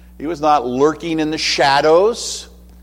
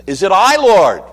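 raised, louder anxious plea; anxious disciple; 7 semitones higher, 7 dB louder than usual. Acted. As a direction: ask Jesus as if afraid it's you. Disciples anxiously ask Jesus.

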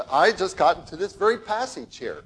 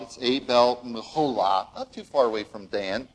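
ordinary talk

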